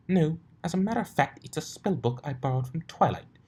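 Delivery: high, prim lady voice; refined mare, haughty; posh lady pony. Acted. Rarity retake.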